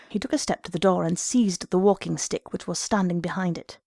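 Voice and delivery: ordinary delivery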